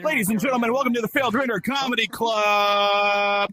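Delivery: hyped MC shout to the crowd; rally speech. Acted. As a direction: work up the room like a club emcee. Comedy club host opening.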